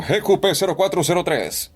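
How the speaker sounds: reading the plate in a character voice, pitch a bit up; first of several voice takes on a plate; a put-on voice. Shown as a demonstration. Voice actor in his car reads a plate, character voice. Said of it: "Hago cualquier voz".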